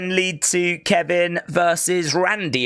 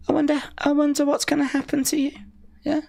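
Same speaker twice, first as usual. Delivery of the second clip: quick high teasing threat; mock menacing; 11 semitones higher, faster than usual. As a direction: tease him with a sing-song threat.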